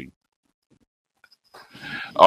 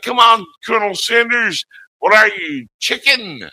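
squeaky hammed-up taunt, much higher and louder; high mock taunting shout; 3 dB louder than usual. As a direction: taunt him like a cartoon villain. Taunting quote from Spaceballs.

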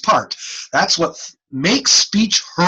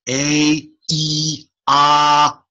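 ordinary talk

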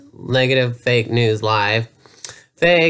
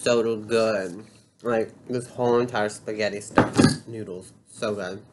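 quiet mukbang voice; 3 semitones lower, 7 dB quieter than usual. Pretend. Parody mukbang, quiet eating talk.

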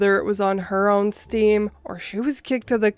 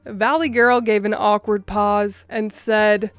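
ordinary delivery

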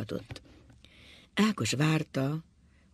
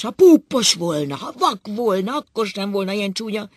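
loud, high-pitched desperate outburst; bellowing; 7 semitones higher, 8 dB louder than usual. A narrator's reading. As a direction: cry out each condition in anguish. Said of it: "ordított Ákos". (kétségbeesetten ordítva)

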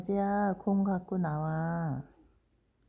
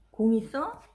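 ordinary talk, nothing marked

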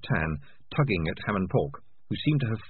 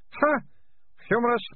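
put-on high scoffing girl; scornful scoff. A narrator's reading. Malicia mocking the rat catcher, high scoff. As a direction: scoff at the word.

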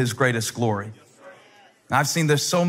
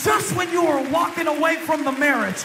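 preaching at full force; 14 semitones higher than usual. Acted. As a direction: drive the congregation to their feet. Preaching climax over music.

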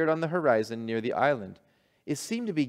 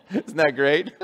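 ordinary delivery

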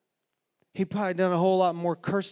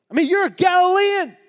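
high, loud accusation; accusing shout; 14 dB louder, faster than usual. Acted. Bystanders accusing Peter, loud high. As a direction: call him out.

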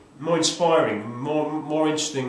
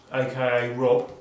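ordinary talk, nothing marked

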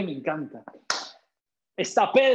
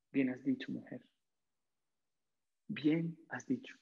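tender Jesus; 7 semitones lower, 11 dB quieter than usual. Acted. Jesus gently praising the Samaritan woman, soft and tender. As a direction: speak the praise softly, as a caring teacher. Jesus repeats the words softly.

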